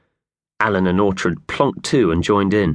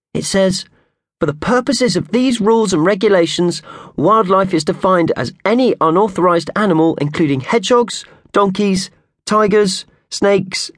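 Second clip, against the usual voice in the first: Purvis reading aloud; 11 semitones higher than usual. Acted. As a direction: read the rules out like a pompous official notice. Purvis reads leaflet aloud.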